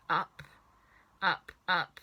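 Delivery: usual speaking voice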